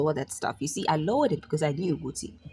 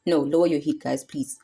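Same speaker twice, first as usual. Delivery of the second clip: ordinary delivery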